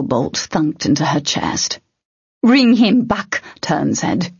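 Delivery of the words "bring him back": higher, slower insistent demand. Acted. Tern after shooting the troll, slow insistent demand. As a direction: press each word of the demand.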